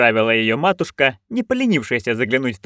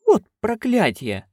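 slow, higher, breathy gasp of shock; gasped curse; 11 semitones higher, slower than usual. Acted. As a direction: gasp it out in disbelief. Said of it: "ахнула она". (ахнув, медленно и выше)

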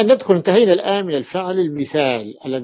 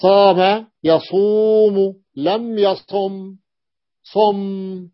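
slow demo; 3 semitones higher, much slower than usual. Shown as a demonstration. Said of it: "أقول".

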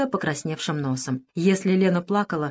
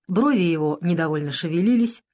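ordinary delivery, nothing marked

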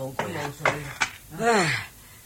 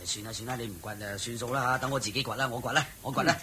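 resigned grumble; 8 semitones lower, 9 dB quieter, faster than usual. Acted. Grumbling, giving up the digging.

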